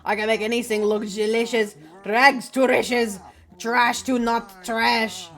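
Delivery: put-on drawn-out French-accented bartender. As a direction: boast as a hammed-up French chef. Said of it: "why is your father French".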